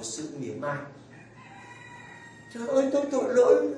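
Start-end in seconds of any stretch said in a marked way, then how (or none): 2.5-3.7 quick, higher cry of a humbled sinner; humbled sinner's cry. Acted. Priest voicing a humbled sinner, quick high cry. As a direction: gasp it out like an unworthy soul.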